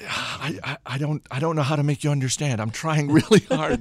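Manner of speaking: playful helpless pleading with a laugh breaking in; mock exasperation. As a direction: act out struggling to get through to someone. Retold explaining to baffled reporters.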